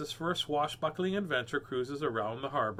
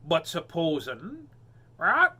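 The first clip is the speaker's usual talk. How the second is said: higher, louder parrot voice; parrot squawk; 6 semitones higher, 6 dB louder than usual. Acted. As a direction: squawk it with sly hope. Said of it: "squawked Parrot Polly".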